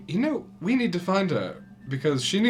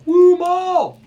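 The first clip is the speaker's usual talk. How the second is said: loud, high whoop of a cheer; cheering Gideon; 10 dB louder than usual. Acted. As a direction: cheer your friend on from the crowd. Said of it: "You hear Gideon go".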